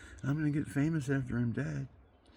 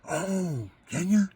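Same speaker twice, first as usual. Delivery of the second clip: mock shocked Monsignor; 8 semitones higher, 5 dB louder, slower than usual.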